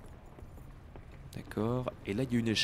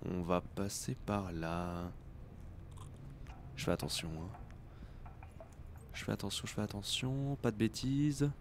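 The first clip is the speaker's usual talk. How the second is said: softer, slow hushed self-talk; whispering while sneaking; 3 semitones lower, 10 dB quieter than usual. Real, not acted. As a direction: murmur so the guard won't hear. Sneaking past a guard.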